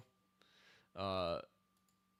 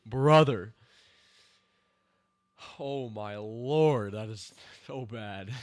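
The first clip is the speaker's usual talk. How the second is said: loud, slow "Oh my Lord"; fed-up disbelief; exasperated; 8 dB louder, slower than usual. Real, not acted. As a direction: groan at how bad it is. Exasperated at the show.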